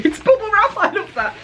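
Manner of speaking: high, giggly burst of delight; delighted laugh. Real, not acted. Unwrapping James's parcel, squealing with delight. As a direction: laugh it out, thrilled by the bubble wrap.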